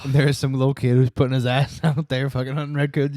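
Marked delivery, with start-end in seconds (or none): none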